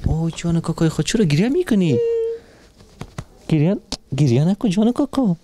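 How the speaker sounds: high-pitched, cooing concern; tender concern. Real, not acted. Host to weeping little girl, tender and alarmed. Comforting a child who starts crying.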